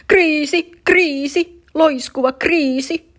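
high, loud mock-alarmed shout; dad joins the panic, shouting high. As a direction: holler the crisis like a siren. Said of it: "huusi isäkin".

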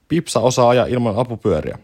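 plain reading voice

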